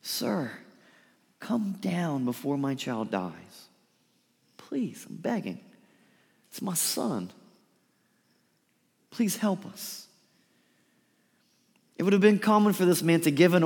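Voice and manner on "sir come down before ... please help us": soft, slow begging that sinks to a whisper on "Please, I'm begging"; a broken father pleading quietly; desperate plea. Acted. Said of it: "you can hear the desperation in his voice".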